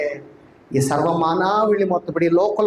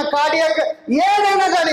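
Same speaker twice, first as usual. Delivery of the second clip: slow rising shout from the pulpit; preaching shout; 7 semitones higher, slower than usual. Real, not acted. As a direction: drive the word home with a shout.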